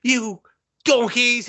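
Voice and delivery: hammed-up Ramsay yell; Gordon Ramsay. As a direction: bellow it like a TV chef. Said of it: "Gordon Ramsay was right".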